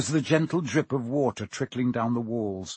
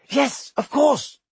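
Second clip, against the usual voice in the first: sudden realization; 13 semitones higher, 5 dB louder, slower than usual. A narrator's reading.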